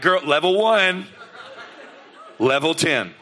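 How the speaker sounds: chuckling through the words; laughing. Real, not acted. Pastor laughing about overreacting, chuckling. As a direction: laugh it off at his own panic. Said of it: "laughing at himself".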